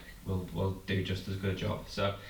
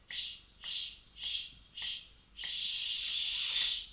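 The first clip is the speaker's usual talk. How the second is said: sh crescendo; much slower than usual. Shown as a demonstration. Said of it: "So let me show you".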